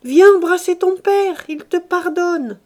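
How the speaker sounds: high and loud, slowing at the end; mother calling Eugénie, overjoyed and loud; joyful call. Acted. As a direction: call out the good news. Mother calls daughter joyfully.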